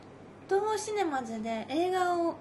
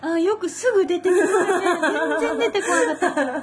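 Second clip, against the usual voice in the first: laughing; 4 semitones higher, 11 dB louder than usual. Real, not acted. Amazed at quick answer.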